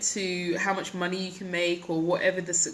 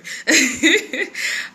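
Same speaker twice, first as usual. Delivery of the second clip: laughing; 8 semitones higher, 7 dB louder than usual. Real, not acted. Laughing at own bad accent.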